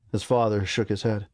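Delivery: ordinary delivery, nothing marked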